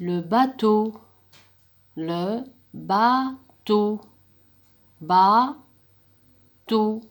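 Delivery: "bateau" stretched slowly and loud; drawn-out modelling. Shown as a demonstration. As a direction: draw out "bateau" for pupils. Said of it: "Ou bien vous pouvez utiliser navire".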